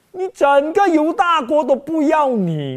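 loud, high, slow jeering; shouted devil mockery; taunting devil. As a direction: hammer home the devil's scorn. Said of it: "魔鬼试探他".

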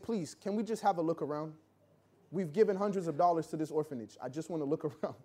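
quiet, earnest plea to the orphanage staff; pleading softly. Retold or imitated. Wife begging the orphanage worker to let them look, soft plea. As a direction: ask gently, almost embarrassed, with a small laugh.

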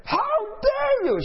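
very high, drawn-out screech; imitated angry yelling. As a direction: act out a livid woman yelling. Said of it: "She's yelling at me".

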